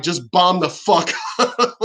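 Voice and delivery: slow command dissolving into laughter; laughing mock order. Imagined president sending an aircraft carrier, laughing through the order. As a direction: draw out the pretend order and crack up on the end.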